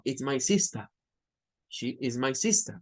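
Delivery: "sister" said as "sista", final R dropped; non-rhotic British. Shown as a demonstration. Teacher imitating a Briton saying "sister". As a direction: end "sister" on an open schwa. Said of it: "O dice algo como".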